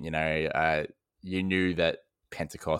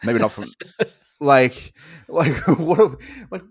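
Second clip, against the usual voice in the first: sputtering laugh; 7 semitones higher, 7 dB louder than usual. Real, not acted.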